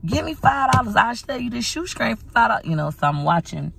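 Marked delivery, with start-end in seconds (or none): 0.0-2.7 high, eager hustler voice; mock cousin hustling. Vlogger imitates her cousin begging for five dollars. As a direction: beg like a street hustler. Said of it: "be like".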